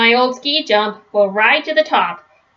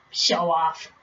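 teasing aside; 5 semitones lower, 8 dB quieter than usual. Real, not acted. Narrator's aside about Papa.

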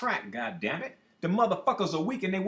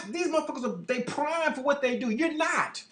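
ordinary talk, nothing marked

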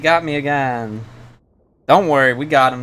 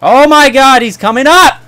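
high, loud frightened yell; panicked scream; 12 semitones higher, 11 dB louder than usual. Real, not acted. Gamer seeing a grasshopper charge him, panicked scream. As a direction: shriek as the bug charges.

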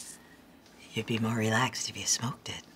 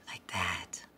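very soft whispered aside; 5 semitones lower, 7 dB quieter than usual. Shown as a demonstration. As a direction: breathe the words out softly. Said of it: "soothing sounds".

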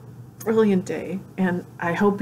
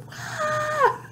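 very high, small squeal of delight; excited squeal. Retold or imitated. Fan reacting to news of an Instagram Live, excited squeal. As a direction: let out a thin, high fangirl squeal. Acts out thrill at live announcement.

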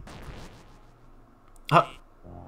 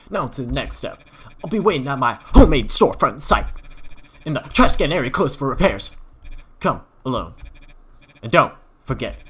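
loud, pushy huckster pitch; hard-sell the player like a crazed salesman; weird salesman voice; 5 dB louder than usual. Acted. Salesman hustling the kid, loud and pushy. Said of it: "the weirdest character I've ever had to voice".

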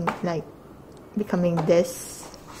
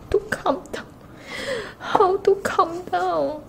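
fangirling whine; 8 semitones higher than usual. Real, not acted. Overexcited fan asking how to calm down, high whine. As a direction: whine it high, as if unable to cope.

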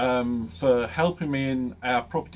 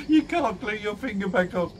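laughing through words; 8 semitones higher than usual. Real, not acted.

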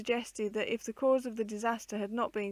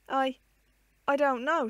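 halting, broken start, louder; stammering; 4 semitones higher, 6 dB louder than usual. A narrator's reading. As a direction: stammer it out in shock. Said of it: "she stammered".

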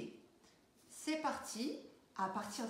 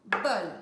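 bol said loud as one punched syllable; one clear beat; 4 semitones lower, 8 dB louder than usual. Shown as a demonstration. Teacher counting one syllable in bol for the children. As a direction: clap out bol as a single beat. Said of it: "Elle s'arrête à une syllabe".

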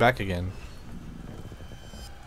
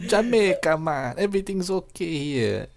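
mock Jamaican accent, pitched higher; 7 semitones higher than usual. A fake Jamaican tourism pitch about the zombie-ridden city. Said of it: "oh, Jamaican".

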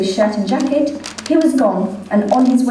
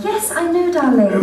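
higher, sing-song mother calling her son; doting mother voice; 8 semitones higher than usual. Acted. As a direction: coo at your grown son like a fond mother. Mother voice in told story.

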